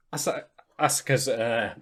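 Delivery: stumbling over the name; false starts, then the name at last; tongue-tied. Real, not acted. Podcaster fumbling a character's name, tongue-tied. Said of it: "sorry, I'm getting tongue-tied here".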